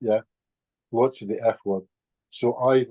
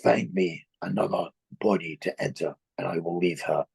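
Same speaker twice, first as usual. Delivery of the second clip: lower, lightly voiced entity; a lowered, lightly played spirit's demand; the entity bargains, in a lower voice; 6 semitones lower than usual. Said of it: "the voice said".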